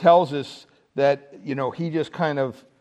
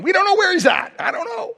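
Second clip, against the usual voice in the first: excited outburst; 13 semitones higher, faster than usual. Real, not acted. Preacher telling his congregation nobody knows, rising to a high cry. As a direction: throw up your hands in exasperation. Preacher exclaims Thomas's absence unknown.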